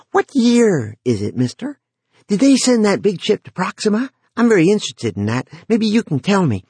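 put-on high, chatty lonely boy; eager teen boy voice. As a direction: talk like a lonely kid wanting company. Narrator holds Jory's voice.

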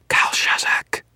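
forceful, hissing whisper. A narrator's reading. Sorcerer summoning a demon by name, whispering. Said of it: "Victor whispered".

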